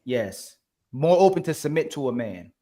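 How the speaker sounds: normal talking voice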